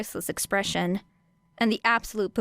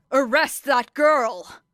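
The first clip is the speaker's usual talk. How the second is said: louder, slow commanding shout; 5 semitones higher, 5 dB louder, slower than usual. Acted. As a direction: bellow the order across the room. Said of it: "shouted Eraserhead".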